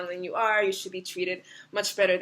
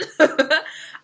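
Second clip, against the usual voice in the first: laugh; 7 semitones higher, 7 dB louder than usual. Real, not acted. Laughs at card.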